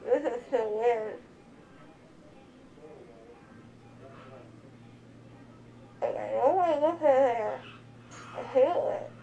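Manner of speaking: slurred words, numb lip; groggy after anesthesia, mouth numb; numb from the dentist. Real, not acted. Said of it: "so you feel your lip now".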